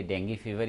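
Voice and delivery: "डेंगू" said den-gee, ending in "ee"; correct pronunciation. Shown as a demonstration. Doctor settling the hosts' debate, "डेंगू" said den-gee. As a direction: say "डेंगू" with a final "ee". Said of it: "इसका exact pronunciation".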